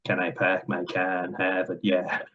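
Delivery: put-on slow, heavy Boston accent; thick Bostonian accent. Imitating a Boston Globe director asked for a sentence, thick Bostonian accent. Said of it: "sentence in his thick Bostonian accent".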